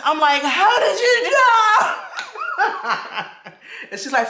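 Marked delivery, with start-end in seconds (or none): 0.4-1.9 slow, high, tearful laugh-cry; laughing through tears. Real, not acted. Asks her friend how she knew, laughing through tears. Crying while laughing in shock. 2.0-3.9 tearful laugh. Real, not acted. Overwhelmed laughter.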